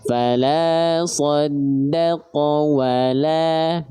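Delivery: "wala" with a thin, light lam, drawn out very slowly; thin lam, recited. Shown as a demonstration. Quran teacher reciting for students, slow thin lam. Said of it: "Lamnya tipis".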